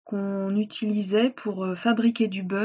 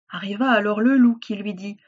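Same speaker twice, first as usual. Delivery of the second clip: plain reading voice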